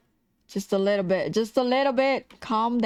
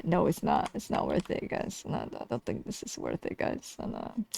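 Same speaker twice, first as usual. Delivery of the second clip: barely voiced, much softer aside; dejected muttering; 5 semitones lower, 8 dB quieter than usual. Real, not acted. Streamer doubting the work to viewers, dejected mutter. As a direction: mutter it low under your breath. Answers own question, giving up.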